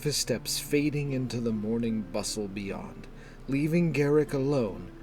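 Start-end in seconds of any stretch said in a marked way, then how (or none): none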